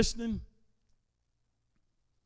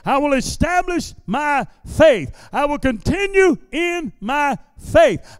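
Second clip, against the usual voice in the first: rising, shouted faith declarations; preaching at full force; 2 semitones higher, 4 dB louder, slower than usual. Acted. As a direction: build it up line by line like a vow.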